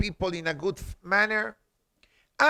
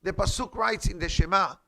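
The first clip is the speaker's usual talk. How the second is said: ordinary delivery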